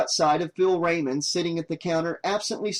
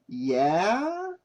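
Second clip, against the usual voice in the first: suspicious; 3 semitones higher than usual. A narrator's reading. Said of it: "he said suspiciously".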